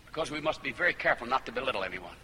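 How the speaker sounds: ordinary talk, nothing marked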